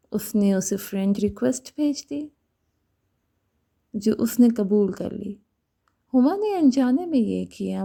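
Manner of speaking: plain reading voice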